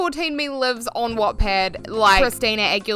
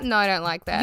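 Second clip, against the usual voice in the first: ordinary talk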